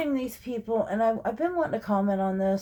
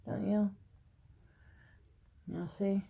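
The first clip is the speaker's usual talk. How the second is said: usual speaking voice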